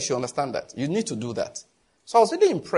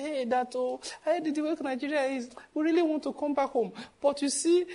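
mock weary aunt, higher and softer; imitating auntie; 9 semitones higher, 5 dB quieter than usual. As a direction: sigh it out like the tired auntie. Pastor voices aunt complaining.

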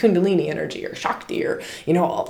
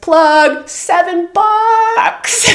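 drawn-out announcer-style pitch, climbing very high and loud; hammed-up plug; 13 semitones higher, 7 dB louder, much slower than usual. Host plugging their own offer to podcast listeners, hammed-up. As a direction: stretch the price out into a high, loud mock fanfare.

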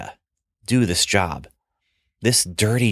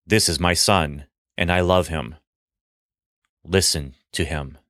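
voice of God; 5 semitones lower than usual. Acted. God's voice from the cloud.

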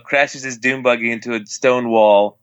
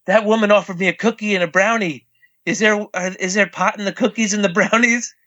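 high, fast, jittery worry; paranoid panic; 8 semitones higher than usual. Retold or imitated. Imitating his son's paranoid panic about cookies. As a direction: rattle it off like a scared kid. Son freaking out over edibles.

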